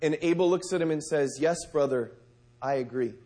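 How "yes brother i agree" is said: soft agreement. Acted. Abel agrees with Cain.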